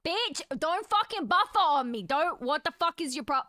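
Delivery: loud furious yell at the lagging stream; angry yell. Real, not acted. Streamer yelling at her buffering stream, angry and loud.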